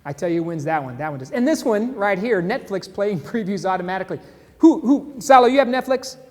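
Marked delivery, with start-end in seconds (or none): 4.6-6.2 calling out. Real, not acted. Calling to co-host.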